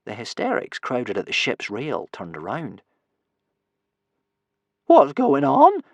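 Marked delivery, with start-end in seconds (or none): 4.9-5.8 high, loud demand; loud, raised-pitch character voice; voiced warrior's question. A narrator's reading. Ferociously Violent asking what is happening, loud high question. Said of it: "asked Ferociously Violent".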